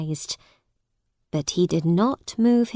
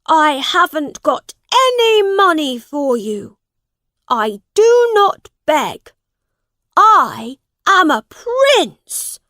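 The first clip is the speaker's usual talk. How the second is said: high, loud royal outrage; defiant prince; 8 semitones higher, 8 dB louder than usual. A narrator's reading. Prince refusing to beg for the father, defiant. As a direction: refuse at full voice, slowing to proclaim the title.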